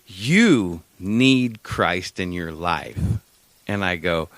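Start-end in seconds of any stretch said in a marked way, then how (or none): 0.0-3.0 very slow, weighty pronouncement; dead serious. Retold or imitated. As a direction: draw each word out with grave conviction. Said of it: "he was dead ass serious".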